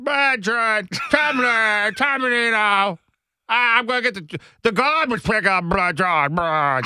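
high, garbled mayor impersonation; hammed-up Boston politician, words mushed together; Tom Menino impression. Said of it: "just start walking around going".

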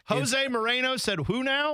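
high, drawn-out confused question; hammed-up baffled fan, slow and pitched high; mock bewilderment. Playing an American fan mangling the coach's name, mock bewilderment.

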